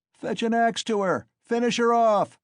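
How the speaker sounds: shouting. A narrator's reading. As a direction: urge the kill from the crowd, high and loud. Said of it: "shouted a third".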